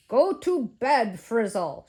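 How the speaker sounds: loud, slow ordering shout. A narrator's reading. Ghost shouting back at the museum man, loud order. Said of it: "shouted Ghost".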